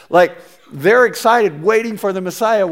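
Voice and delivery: rising thrilled tone; eager excitement. Retold or imitated. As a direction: sell the crowd's excitement. Crowd eager for Messiah.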